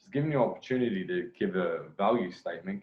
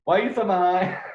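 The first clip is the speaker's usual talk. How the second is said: higher and louder put-on voice; mock confused girl; 8 semitones higher, 6 dB louder than usual. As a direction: play her baffled question in a changed voice. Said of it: "What the fuck is this girl saying?".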